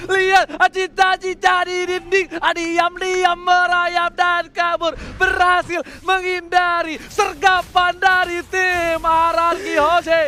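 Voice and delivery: loud, high hype call; excited shout. Real, not acted. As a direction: yell the play with rising hype. Big play caught on screen.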